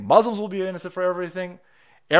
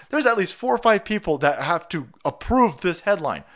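usual speaking voice

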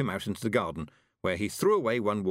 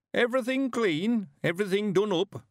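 put-on an eager old countryman, chuffed; old Mister Weevil; 12 semitones higher than usual. Old man voice held.

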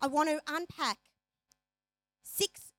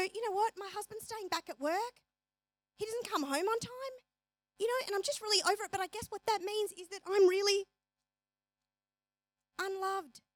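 self-pity; 6 semitones higher than usual. Retold or imitated. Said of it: "Or we go".

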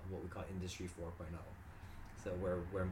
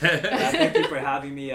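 loud, higher, laughing thanks; 7 semitones higher, 19 dB louder than usual. Real, not acted. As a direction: thank them through a laugh.

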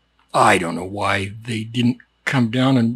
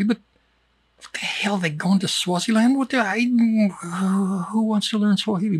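higher, incredulous what-the-hell rant; mock exasperation; 8 semitones higher than usual. Comic teacher mocking Swahili learners, incredulous. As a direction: scoff at the whole idea in disbelief.

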